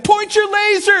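high, loud coaching yell. Retold or imitated. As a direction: call it out to the batter. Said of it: "I was like".

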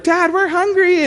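put-on high, slow whining child voice; whiny kids. Kids whining to their dad for food, high and slow.

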